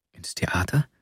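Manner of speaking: a breathless whispered question; startled whisper. A narrator's reading. The girl whispers her startled reply to the lodger. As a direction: whisper it, caught off guard.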